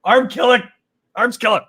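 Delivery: high-pitched comic distress; hammed-up exasperation; mock meltdown. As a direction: complain in a high, worked-up voice. Mock meltdown over silly word.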